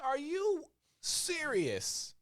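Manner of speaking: high, slow, disbelieving squeak; incredulous. Retold or imitated. Mocking a game studio, drawn-out high disbelief. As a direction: stretch it out in mock disbelief. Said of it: "I'm like".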